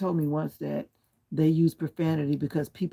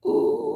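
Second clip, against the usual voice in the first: long, high, loud "ooh"; 8 dB louder than usual. Real, not acted. Streamer reacting on a live chat, high drawn-out ooh. Lone wordless reaction on livestream.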